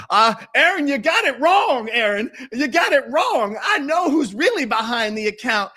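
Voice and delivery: high, hammed-up mimicry of a critic; mocking high voice. Mocking a chat critic, high squeaky impression. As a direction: squeak it out to mock her. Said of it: "has been in the chat claiming".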